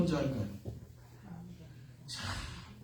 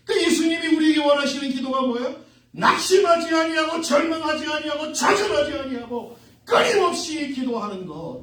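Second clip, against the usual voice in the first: loud, higher-pitched sermon delivery; forceful preaching; 9 semitones higher, 6 dB louder than usual. Acted. Preacher urging unceasing prayer, forceful and raised. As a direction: drive each phrase home to the congregation.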